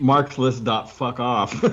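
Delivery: slow, drawn-out mock web address; deliberately slow. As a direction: spell it out slowly for the joke. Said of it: "slow mocking joke".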